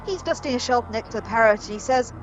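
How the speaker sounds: normal talking voice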